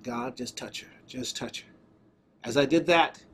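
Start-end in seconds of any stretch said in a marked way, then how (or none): 0.0-1.7 soft, low plea to God; quiet prayer. Retold or imitated. Preacher praying over a woman with his hand on her, quiet prayer. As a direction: pray it softly, almost to yourself.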